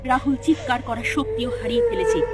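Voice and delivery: ordinary delivery, nothing marked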